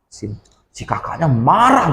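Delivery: usual speaking voice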